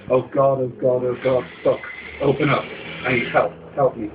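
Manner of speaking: panicked pleading. Acted. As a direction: beg in panic at a shut door. Panicked begging at door.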